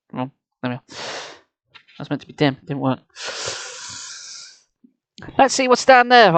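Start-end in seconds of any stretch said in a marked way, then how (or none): 5.4-6.3 urging. A narrator's reading. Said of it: "I urged Nicole".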